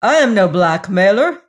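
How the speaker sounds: loud, indignant denial; wrathful cry. A narrator's reading. Hagar refusing the lady's money, loud and wrathful. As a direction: throw off the accusation angrily. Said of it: "cried Hagar wrathfully".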